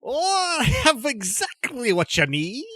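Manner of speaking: eccentric merchant voice. Acted.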